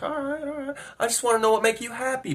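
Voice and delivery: high, soft placating voice; imitated coaxing woman. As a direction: soothe him in a high imitated voice.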